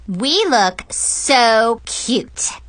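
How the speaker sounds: put-on slow, drawn-out girlish gush; gushing, each word stretched out. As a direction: savour every word with delight. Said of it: "Zoe gushed".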